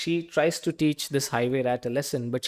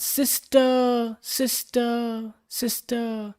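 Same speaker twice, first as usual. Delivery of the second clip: echo; 8 semitones higher, much slower than usual. A narrator's reading. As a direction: make it sound like a cave echo. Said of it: "a voice from the cave came back".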